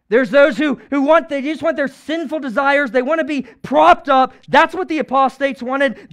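loud, high, insistent denunciation; impassioned pulpit shouting; fiery preaching. Real, not acted. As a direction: hammer each accusation home. Preaches against religious leaders.

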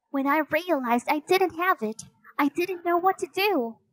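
put-on high girlish character voice; high-pitched young heroine confiding; Annie, earnest girl. Narrator voices Annie explaining.

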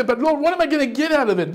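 high, fast, mock-whining question to the Lord; a believer complaining to the Lord, high and quick; whiny believer. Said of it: "And you say".

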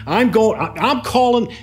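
high pitched, heated demand; imitating a client's angry threat; retold screaming. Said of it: "he starts screaming at me".

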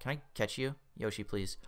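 ordinary talk, nothing marked

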